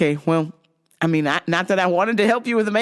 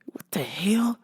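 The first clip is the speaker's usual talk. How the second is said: unvoiced, stunned whisper; 2 semitones lower, 7 dB quieter, much slower than usual. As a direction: breathe it out in shock, barely audible. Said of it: "And I was like".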